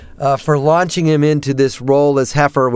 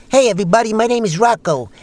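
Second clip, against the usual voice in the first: higher-pitched character voice introducing himself; Bruno Kirby; 5 semitones higher than usual. Acted. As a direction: put on a raised actor impression for an audition. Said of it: "try a Bruno Kirby".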